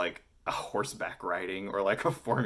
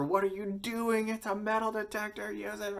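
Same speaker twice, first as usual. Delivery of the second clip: high-pitched, louder mock yell of disbelief; 4 dB louder than usual. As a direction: imitate exasperated teammates yelling. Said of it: "Everyone is yelling at her".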